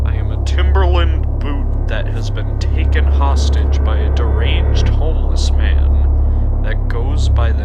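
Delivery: dramatic storyteller reading over music; story narration. A narrator's reading. Narrator reading a shoe's story to viewers, dramatic. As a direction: narrate it like a grim tale.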